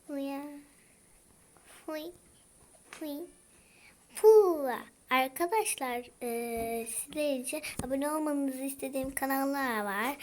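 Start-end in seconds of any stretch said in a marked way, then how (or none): 4.1-4.8 loud puff. Real, not acted. Exclaims while playing.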